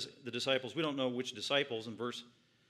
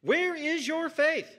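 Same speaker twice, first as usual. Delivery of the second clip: high, loud, slow demanding question; emphatic rebuke; 10 semitones higher, 5 dB louder, slower than usual. Acted. Jesus to the disciples, loud and emphatic. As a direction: press them hard on their faith. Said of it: "He's pretty emphatic".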